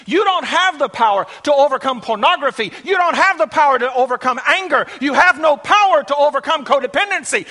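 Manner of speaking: high, loud, relentless pulpit cries; full-force preaching. Real, not acted. Preacher telling strugglers they lack the power, full-force preaching. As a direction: hammer each line home, building in force.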